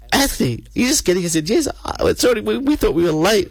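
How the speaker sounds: high, bright welcome cry; excited greeting. Retold or imitated. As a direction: call his name out high with delight.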